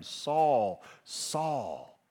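a commanding heavenly voice calling the name twice. Acted. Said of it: "he heard a voice saying to him".

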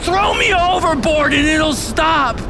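high, loud yell; shouted instruction. Acted. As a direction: shout it over the storm. Jonah shouting in the storm.

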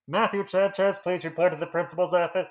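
loud muffled announcement through cupped hand; cupped hand intercom. Pretend. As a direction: call it out like a school intercom, hand over mouth. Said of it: "the person cups their hand over the mouth so it sounds a little bit like".

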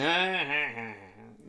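put-on giggling laugh; mock laugh, held long and trailing; imitated laughter. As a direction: imitate someone bursting into drawn-out laughter. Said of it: "Guruji just starts laughing".

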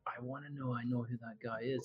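hushed regretful thought; quiet inner thought. Retold or imitated. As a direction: say it under your breath. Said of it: "and you're like".

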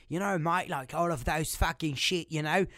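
casual mock-British English; British accent. Shown as a demonstration. Showing off favourite accent, mock British. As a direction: toss it off in a loose British accent. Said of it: "le genre de British".

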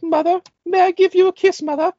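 high proper English lady voice; man doing a woman's voice. Retold or imitated. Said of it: "this proper little English lady voice".

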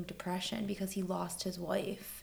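hushed whisper. Real, not acted. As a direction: drop to a whisper. Sad detail about Wim Hof.

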